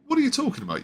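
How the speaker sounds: high mock outrage; mock disbelief. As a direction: squeak it out like it makes no sense. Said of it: "kinda like".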